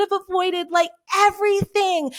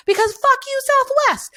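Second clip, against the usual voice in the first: angry outburst; 9 semitones higher, faster than usual. Real, not acted. Ranting about airline bailouts, angry outburst. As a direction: spit it at the airline.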